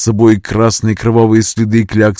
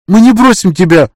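loud, high refusal to leave; desperate cry; 10 semitones higher, 6 dB louder than usual. Acted. Friend refusing to leave her.

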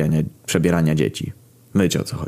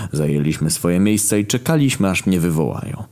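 ordinary talk, nothing marked

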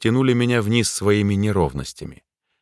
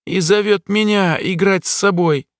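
put-on high, eerie child voice; childlike voice; 11 semitones higher than usual. A narrator's reading. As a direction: speak like a possessed boy. Possessed jester, childlike.

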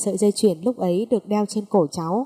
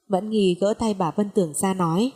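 plain reading voice

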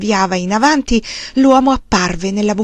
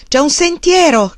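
high, loud call; 7 semitones higher, 5 dB louder than usual. A narrator's reading. Woman calling to the man about the path, loud call. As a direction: call it out to someone far off. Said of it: "chiamò".

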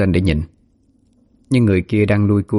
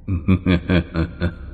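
a menacing ghost's cackle, held back; evil cackle; 2 semitones lower, 4 dB quieter than usual. Acted. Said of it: "Con ma cười lên khăng khặc".

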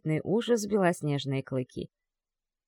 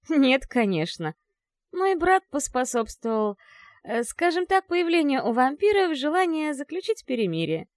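wry grimace; 7 semitones higher than usual. Acted. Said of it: "скривилась и ответила".

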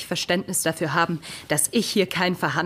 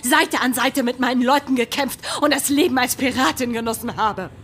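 higher and louder, proud and fierce; defiant shout; 7 semitones higher, 4 dB louder than usual. Acted. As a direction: stand your ground and yell back. Sie schreit zurück. (trotzig laut zurückgerufen)

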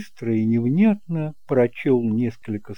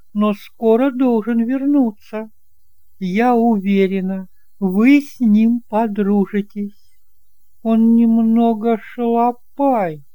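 put-on higher and louder, a fussing elderly aunt; chatty kind aunt; 11 semitones higher, 4 dB louder than usual. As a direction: play the doting aunt, bright and eager to please. Aunt voiced by narrator.

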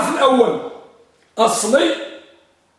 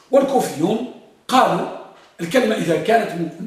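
ordinary talk, nothing marked

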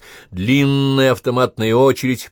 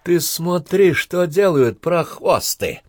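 high, loud astonishment; raised voice full of amazement; amazed; 10 semitones higher, 4 dB louder, much slower than usual. A narrator's reading. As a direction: marvel out loud at the sight. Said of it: "с изумлением заметил Полянкин".

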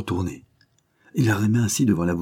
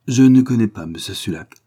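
louder, higher, a flat firm denial; 4 semitones higher, 7 dB louder than usual. Acted. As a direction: plant your feet and deny it. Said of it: "affirma-t-il".

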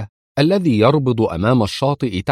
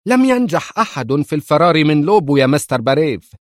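higher, louder angry outburst; angry shout; 6 semitones higher, 3 dB louder than usual. Acted. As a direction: snap it out in anger. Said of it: "صاح إدوين بغضب". (صيحة غاضبة)

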